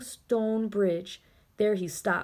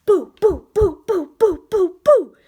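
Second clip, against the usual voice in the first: much higher, louder ghostly boos; scary boos; 10 semitones higher, 12 dB louder than usual. Acted. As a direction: boo at full force.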